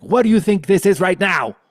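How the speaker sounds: high, very loud outburst; sudden shout. Retold or imitated. Grandmother startling child after clapping, shouted revelation. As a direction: burst out to startle the listener.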